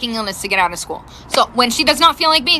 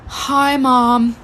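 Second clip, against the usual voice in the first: daughter feigning sickness with feeble sighs; dramatically faked illness. Daughter faking illness to her mom in the office, dramatically pitiful. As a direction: sigh heavily, then draw out a pitiful greeting. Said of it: "dramatically, like".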